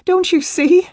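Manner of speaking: very high, loud tearful plea; distraught cry. A narrator's reading. Upset woman pleading with him to understand, crying out. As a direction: cry it out in desperation. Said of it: "she cried".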